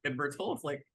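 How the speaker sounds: usual speaking voice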